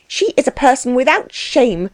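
high, scandalized exclamation; indignant cry. A narrator's reading. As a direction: cry it out in disapproval. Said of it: "cried his wife".

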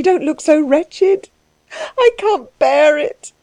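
high, halting, tearful apology; distressed weeping woman, pitched high; sobbing. Acted. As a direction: sob through it in a high, breaking-off voice. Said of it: "she sobbed".

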